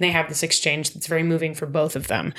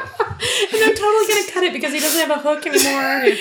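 laughing; 9 semitones higher, 3 dB louder than usual. Real, not acted. Still laughing at the gag.

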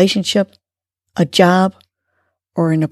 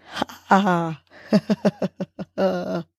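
laughing on the exhale; 7 dB quieter, much slower than usual. Shown as a demonstration. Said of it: "let it out with the laughter".

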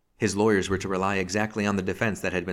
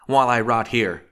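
loud, higher cry of protest; bitter outcry; 4 semitones higher, 8 dB louder than usual. A narrator's reading. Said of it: "he cried again".

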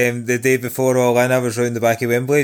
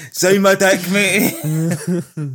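laughing through a mock fan's request; slow, higher giggling plea; 7 semitones higher, much slower than usual. Joking mock fan request, laughing.

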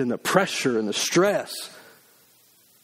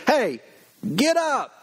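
kick in the rear; 9 semitones higher, slower than usual. Pretend. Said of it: "kick us in the rear and say".

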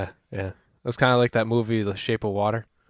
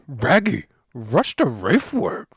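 Scooby-Doo voice; 2 semitones higher, 4 dB louder, slower than usual. Retold or imitated.

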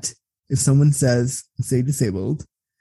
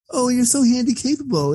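mock sugary praise; 10 semitones higher than usual.

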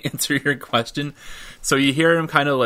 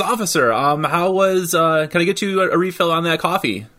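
cheerful host voice; 6 semitones higher than usual. Acted. Barnabas beaming, offering coffee.

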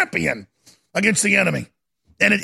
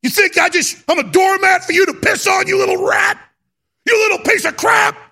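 high, loud angry shouting back; retold furious yell; 11 semitones higher, 4 dB louder than usual. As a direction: yell it like you're facing him down. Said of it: "That's what I told him".

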